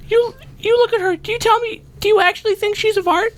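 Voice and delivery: high squeaky voice. Acted. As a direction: squeak it like a cartoon. Player in character voice.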